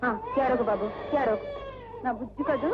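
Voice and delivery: put-on low, hushed cooing to a crying child; calm the baby down gently; soothing a baby.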